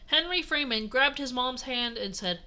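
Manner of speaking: ordinary delivery, nothing marked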